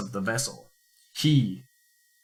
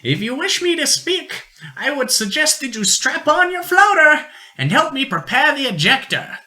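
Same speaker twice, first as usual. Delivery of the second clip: higher, grim explorer voice; 5 dB louder than usual. Acted.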